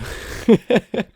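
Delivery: helpless giggle breaking off a take; high, cracking-up laughter. Real, not acted. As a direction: burst out giggling mid-line.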